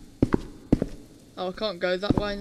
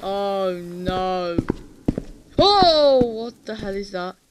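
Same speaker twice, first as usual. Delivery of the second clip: startled, rising to a slow scared yell; scared horror game streamer; 13 dB louder, much slower than usual. Real, not acted. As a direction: jumps at the monster, then cries out. Monster appears in horror game.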